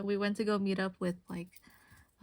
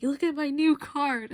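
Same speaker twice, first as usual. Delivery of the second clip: bright, louder friend showing off a card; excited friend; 5 semitones higher, 7 dB louder than usual. Retold or imitated. Friend showing off new card, excited and louder. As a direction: gush over your new card. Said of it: "my friends will just be like".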